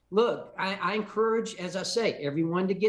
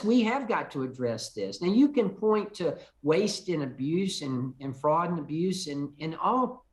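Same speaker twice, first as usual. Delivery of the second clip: normal talking voice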